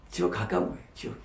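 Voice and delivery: hushed whisper on 가까운. Acted. Preacher whispers to the congregation how near hell is, hushed. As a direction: breathe it out, secret and grave.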